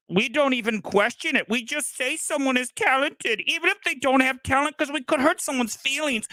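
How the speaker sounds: high-pitched mock-nice voice; high, sing-song mockery of politeness; mocking people-pleaser. Mocks overly nice film fans.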